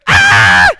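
very loud mock scream; imitated scream. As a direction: let out a sudden, piercing scream in imitation. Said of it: "She screams".

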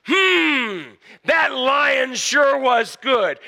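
high, slow, drawn-out boast; hammed-up loud satisfied "hmm"; real loud. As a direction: call out loudly to be overheard. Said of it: "he says real loudly".

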